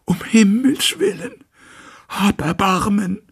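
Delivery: very high strange whisper, begging; Hyde's whispered plea. A narrator's reading. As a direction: beg for mercy in a thin alien whisper. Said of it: "das ist Hydes Stimme". (sehr hohes, fremdes, flehendes Flüstern)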